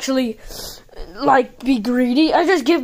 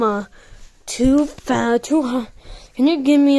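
ordinary talk

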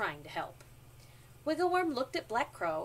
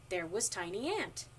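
plain reading voice

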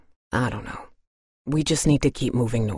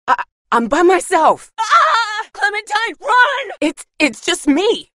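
much higher, loud alarmed cries; startled shouting; 7 dB louder than usual. Acted. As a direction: yell the warning in sudden fright. Startled in the dark.

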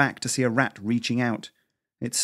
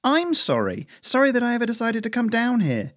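higher, bitter mocking echo; 13 semitones higher, 4 dB louder than usual. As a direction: throw the apology back scornfully. Mocking the apology back.

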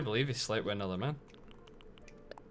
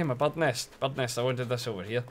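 ordinary talk, nothing marked

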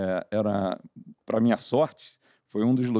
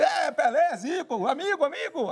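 excited chief's son; 12 semitones higher, slower than usual. Retold or imitated. Chief's son excited over shirt.

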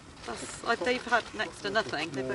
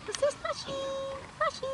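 cooing to dog; faster than usual. Real, not acted.

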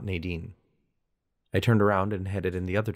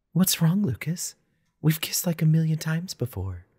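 high, coaxing female character voice; ex-girlfriend's voice; 9 semitones higher than usual. A narrator's reading. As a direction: play the unbothered ex, pitched well up. Narrator voices Lena, the ex.

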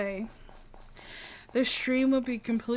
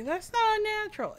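mock objection; 9 semitones higher than usual. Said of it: "people may say".

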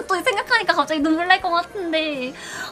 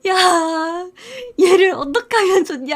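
friends laughing; 5 dB louder than usual. Retold or imitated. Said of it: "친구들 막 웃으면서".

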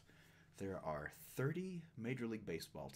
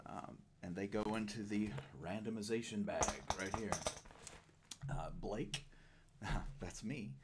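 ordinary talk

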